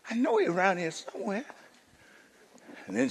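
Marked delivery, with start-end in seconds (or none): 0.1-1.4 lightly voiced child, softly to herself; hushed, much softer searching voice; daughter searching. Daughter hunting for her hidden father, lightly voiced and soft. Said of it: "And she said".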